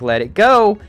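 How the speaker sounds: quick first parental demand; firm dad order. Acted. Acted dad's first order.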